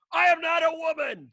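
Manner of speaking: high, loud, hammed-up protest; mock indignant shout. Retelling what he wanted to yell at the waiter. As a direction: blurt it out, offended and mock-outraged. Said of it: "I was like".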